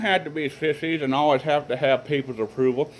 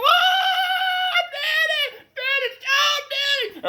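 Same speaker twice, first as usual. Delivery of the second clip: put-on very high, slow wailing little boy; mock screaming kid; much slower than usual. Brother hanging from the truck door calls to Daddy, high mock wail. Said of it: "he's screaming".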